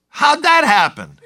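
high, loud amazed cry; amazed question. Acted. Neighbors to man who can now see, amazed question.

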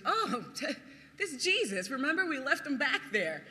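a touch of light, chuckling casual thought; laughing mock recollection. Disciples mockingly remembering Jesus, laughing. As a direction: toss it off through laughter. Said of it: "The last thing they thought".

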